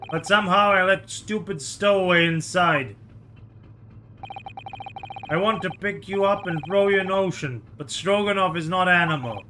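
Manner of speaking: put-on lightly Russian-accented guard, proud; a hint of a Russian accent, boasting; mock Russian guard showing off; Russian sailor voice.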